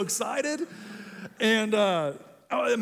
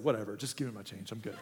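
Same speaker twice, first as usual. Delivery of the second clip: deflated brush-off; 10 semitones lower, 7 dB quieter, faster than usual. Retold or imitated.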